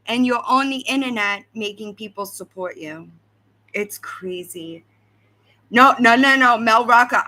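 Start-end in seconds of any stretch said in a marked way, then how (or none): 5.7-7.2 emphatic no. Real, not acted. Loudly rejects a chat comment.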